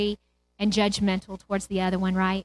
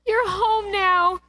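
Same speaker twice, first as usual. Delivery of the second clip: higher, slow, lingering emphasis; drawn-out joyful echo; high slow savouring; 10 semitones higher, slower than usual. Shown as a demonstration. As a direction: savour each word with rising delight. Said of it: "I love this story".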